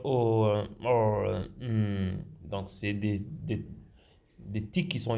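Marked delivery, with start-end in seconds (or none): 0.0-2.5 1 hesitation filler. Shown as a demonstration. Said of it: "vous pouvez dire".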